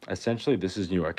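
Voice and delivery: ordinary talk, nothing marked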